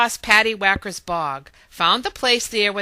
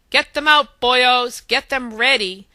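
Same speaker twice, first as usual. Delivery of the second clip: rallying call; 4 semitones higher, 7 dB louder than usual. A narrator's reading. Ari rallies them to work.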